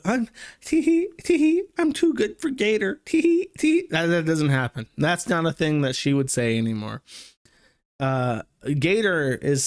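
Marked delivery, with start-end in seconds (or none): 0.0-3.9 put-on very high tee-hee girly voice; mock giggly girl voice. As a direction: giggle it out like a cutesy anime girl mocking her. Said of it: "the most annoying fucking voice".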